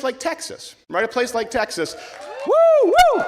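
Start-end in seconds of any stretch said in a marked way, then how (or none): 2.5-3.2 high, loud whoop; cheer. Real, not acted. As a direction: whoop for the crowd. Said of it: "cheering for Texas".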